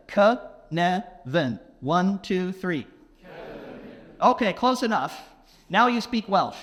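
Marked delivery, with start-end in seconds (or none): 0.1-1.6 "Ke-ne-vin" said slowly, each syllable apart. Shown as a demonstration. Teaching the audience the Welsh word, slow syllables. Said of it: "put the Kevin and the ne together".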